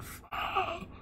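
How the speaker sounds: high, strangled mock yelp of a stubbed toe; retold curse, yelped high and small; pained yelp. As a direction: squeak it out like you just hit your foot. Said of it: "Oh, and I went".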